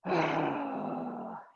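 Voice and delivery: scoffing sigh of frustration; grumbling frustrated sigh. Shown as a demonstration. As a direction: let the sigh grumble out in annoyance. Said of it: "if you're really frustrated with someone, you go".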